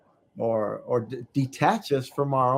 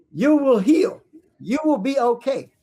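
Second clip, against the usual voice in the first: put-on higher, louder, hurried reassurance to a hurt kid; brushing-off parent; 8 semitones higher, 6 dB louder than usual. Parent hushing a crying child with quick cheer. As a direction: chirp it to get past the tears.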